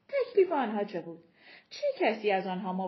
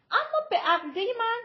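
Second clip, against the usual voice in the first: high, raised objection; daughter protesting; 12 semitones higher, 5 dB louder than usual. A narrator's reading. Tita daring to oppose her mother's rules, protesting. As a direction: speak up against her, voice pitched high.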